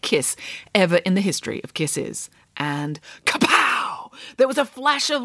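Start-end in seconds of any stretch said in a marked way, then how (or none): 2.6-5.2 dramatic. A narrator's reading. Dramatic storytelling.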